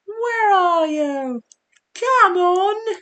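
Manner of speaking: very high, drawn-out mock yell; old-clip impression. Streamer imitates a funny old clip, high stretched-out yelling. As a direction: imitate a long, loud falsetto holler. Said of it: "those old clips are so funny".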